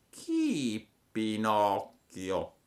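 lentissima, strascicata; lumaca lenta. A narrator's reading. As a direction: allunga ogni sillaba. La lumaca chiede. (la lumaca chiede lentissima)

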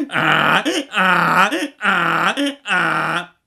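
loud, high hee-haw brays; repeated full-force donkey brays; donkey braying. A narrator's reading. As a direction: bray resoundingly like a donkey. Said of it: "ragliando sonoramente, facevano tutte e due in coro".

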